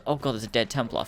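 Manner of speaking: ordinary delivery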